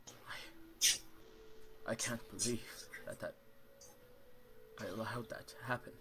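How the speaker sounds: hushed, halting apology with long pauses; grief-stricken lord. Acted. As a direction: struggle to get each word out, stunned. Grieving lord apologizes, acted.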